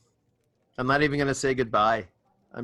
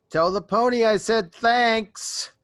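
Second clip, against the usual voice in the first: higher, slow sarcastic thanks; 9 semitones higher, 5 dB louder, slower than usual. Acted. Druid calling up to the griffin before climbing down, sarcastic. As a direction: lay the thanks on with mock sweetness. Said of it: "I say".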